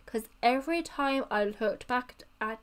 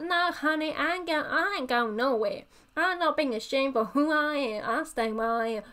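higher-pitched sassy American putdown; sassy American accent; 4 semitones higher than usual. Acted. Vampire refusing to be chased away, sassy American accent. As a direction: put on American-accented sass. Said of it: "They got a bit sassy".

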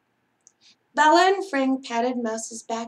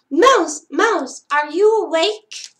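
louder urgent call; 4 semitones higher, 6 dB louder than usual. Acted. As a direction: call up to a sleeping friend. Calling at flowerpot.

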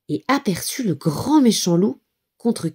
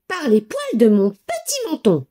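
firm pig; 6 semitones higher than usual. A narrator's reading. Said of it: "répondit Nouf Nouf".

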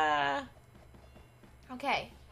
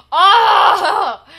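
gasp, then a loud high yell; loud, drawn-out startled cry; startled scream; 5 semitones higher, 12 dB louder than usual. Real, not acted. Gamer jolted by the game, startled scream.

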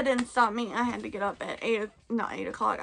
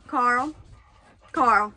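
loud call for the dog; louder calling the dog's name; 9 dB louder than usual. Real, not acted. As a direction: call him sharply across the room.

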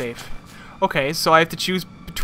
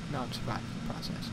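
muttered aside; 4 semitones lower, 16 dB quieter than usual. Real, not acted. Muttering over game text.

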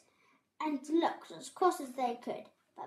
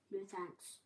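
low, soft grumpy refusal; muttering; 4 semitones lower, 11 dB quieter than usual. A narrator's reading. Babbitty refuses hand sounds, low mutter. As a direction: mutter it under your breath.

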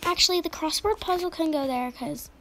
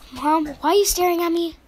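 ordinary delivery

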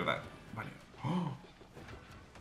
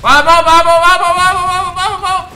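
very high, loud chanted yell; sustained high-pitched screaming cheer; frenzied cheering; 14 semitones higher, 15 dB louder than usual. Real, not acted. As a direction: shout it over and over, wildly excited. Cheering as big moment arrives.

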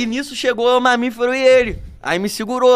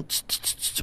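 repeated short hissing bursts; imitated bus horn; 16 dB quieter, slower than usual. Bus pushing to get past, imitated horn hiss. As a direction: mimic the bus honking its way through. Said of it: "A buzina é essa porra".

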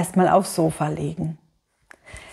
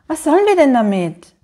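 mildly groaned, higher-pitched question; groaning; 7 semitones higher, 4 dB louder than usual. A narrator's reading. Mama to Papa about Grandpa's books, mildly groaning. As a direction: lightly groan it as an exasperated question. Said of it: "stöhnte Mama".

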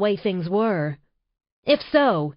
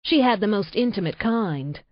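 plain reading voice

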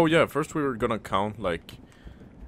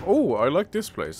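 usual speaking voice

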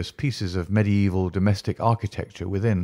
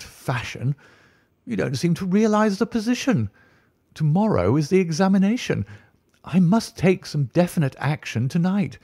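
much higher, pleading, alarmed tutor; astonished dismay; 7 semitones higher than usual. Acted. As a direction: cry out in dismay at being left alone. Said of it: "cried aloud in his astonishment and dismay".

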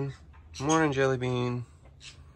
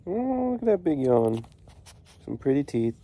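usual speaking voice

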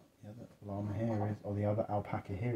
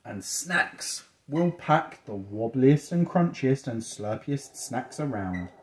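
slow, relishing picture-book narration; reading to children; 2 semitones higher, 4 dB louder, much slower than usual. A narrator's reading. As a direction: stretch out each silly describing word for a child.

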